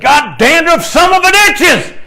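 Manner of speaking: high, loud, strained mock-cursing scream; furious yell. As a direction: yell it at the world like you've lost it. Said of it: "I think I said".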